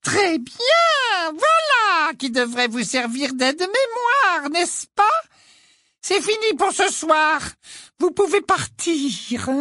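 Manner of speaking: high, slow, sugary sweet voice; syrupy menace, drawn out; wicked stepmother. A narrator's reading. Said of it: "de sa voix doucereuse".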